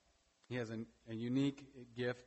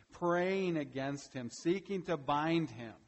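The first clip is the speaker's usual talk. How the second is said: building emphasis; 5 semitones higher, 7 dB louder, slower than usual. Real, not acted.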